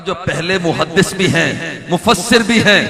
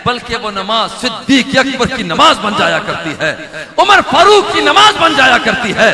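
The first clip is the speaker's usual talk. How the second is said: building preaching climax; 6 semitones higher, 4 dB louder than usual. Acted.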